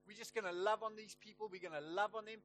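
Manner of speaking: rally the church with passion; fervent preaching. Retold or imitated. Preacher retells calling church to give.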